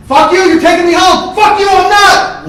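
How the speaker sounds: high, full-volume screamed exchange; furious shouting match. Retold or imitated. Brothers yelling about the ride home, screaming furiously. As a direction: trade screamed insults without letting up.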